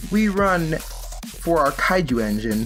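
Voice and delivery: normal talking voice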